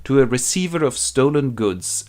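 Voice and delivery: usual speaking voice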